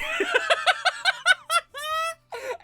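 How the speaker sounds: shrill breathless cackle; high wheezing laugh. Real, not acted. Laughing at own impression.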